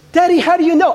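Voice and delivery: put-on high, loud little girl asking Daddy. Daughter asking her father how he knows, in a high child voice. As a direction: pitch up high and plead like a small child.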